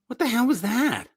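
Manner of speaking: high baffled disbelief; baffled what the hell. Retold or imitated. To a friend about Kiss lyrics, high baffled disbelief. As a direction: sound utterly puzzled by the lyric. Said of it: "you listen to those lyrics and you go".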